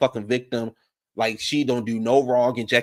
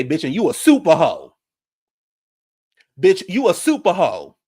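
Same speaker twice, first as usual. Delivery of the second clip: loud retort; 5 semitones higher, 7 dB louder than usual. Retold or imitated. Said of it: "Jackie say".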